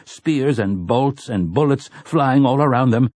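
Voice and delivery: plain reading voice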